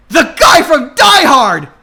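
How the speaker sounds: high, loud announcement; hammed-up dramatic reveal; excited reveal. A narrator's reading. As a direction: blurt the big reveal.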